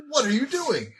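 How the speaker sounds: suspicious elf archer. Acted. Elf archer challenges the prisoners being untied, pitched high. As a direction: snap the question out, pitched high. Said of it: "one of the elf archers says".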